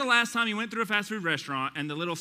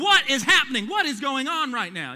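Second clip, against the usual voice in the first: raised, higher, loud demand; exasperated parent; 6 semitones higher, 7 dB louder than usual. Retold or imitated. Parent finding the kids crying in the car, loud exasperated demand. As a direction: call out the chaos as you open the car door.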